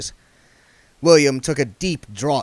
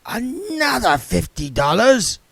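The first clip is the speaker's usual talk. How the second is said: put-on raspy old beggar; 12 semitones higher, 4 dB louder than usual. A narrator's reading. Arnold sideways voice.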